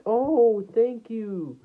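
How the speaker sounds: high-pitched, drawn-out mock thanks; imitated flattered girl. Girl thanking him for praising her name, high imitated gush. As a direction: imitate a girl gushing at a compliment. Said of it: "And then she was like".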